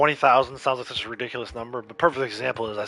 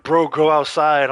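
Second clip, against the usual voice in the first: loud, drawn-out imitation of a critic; mock heckle; 5 semitones higher, 12 dB louder, slower than usual. Streamer mimicking critics of his play hours, loud mock heckle. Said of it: "people will be like".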